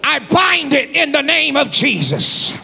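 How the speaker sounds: high, shouted preaching at full force; shouting faith healer. Acted. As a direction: command the sickness out, full voice. Commands healing at full force.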